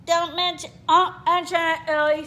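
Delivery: talking through a mouthful of egg; egg-stuffed mock speech; mouth full. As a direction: garble it round an egg. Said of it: "she actually got an egg in her mouth".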